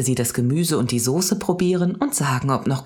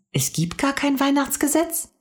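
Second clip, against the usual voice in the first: put-on very high child's question; incredulous little girl; astonished; 13 semitones higher than usual. A narrator's reading. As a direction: pitch leaps up in disbelief. Mia shocked by her conclusion.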